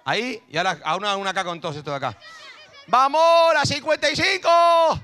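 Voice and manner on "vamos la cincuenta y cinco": very high, drawn-out cheer; grito de aliento. Real, not acted. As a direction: stretch the cheer out in a shout. Grita aliento al sacar la foto. (grito agudo y alargado de aliento)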